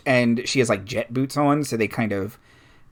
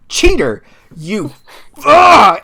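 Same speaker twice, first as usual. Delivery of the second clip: high, loud outburst ending in a scream; furious yell; 8 semitones higher, 10 dB louder, slower than usual. Acted. Luffy leaning out of the cockpit at her rival, furious yell. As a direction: yell it, then break into a wordless roar. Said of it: "she yells".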